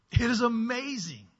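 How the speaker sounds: real amazement bursting out; delighted. Real, not acted. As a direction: gush about the little one. Delighted by toddler's answer.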